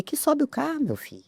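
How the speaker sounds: "Que sobe o carro" thrown up high in disbelief; incredulous outburst. Retold or imitated. Stranded woman to tow driver, high incredulous disbelief. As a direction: scoff at the absurd request, pitch leaping up.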